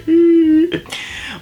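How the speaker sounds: high laugh. Real, not acted. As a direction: burst of high-pitched laughter. Laughs after mock comforting.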